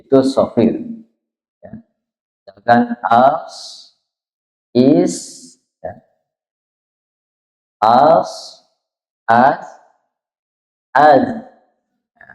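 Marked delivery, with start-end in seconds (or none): 3.0-5.2 "as, is" ending in a drawn hiss. Shown as a demonstration. Teacher giving examples of sofir, hissed endings on "as, is". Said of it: "ada desirannya". 7.8-11.2 "As, az" each closed with an audible hiss; hissing ending. Shown as a demonstration. Teacher demonstrating sofir letters, hissed endings on "As, as, az". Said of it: "Jadi ada desis".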